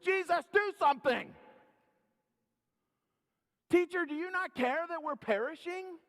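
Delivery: high, loud terrified cry for help; panicked disciples. Acted. Frightened disciples shouting to Jesus in storm, panicked. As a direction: cry out in panic like sailors sinking. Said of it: "The disciples were threatened and they were terrified".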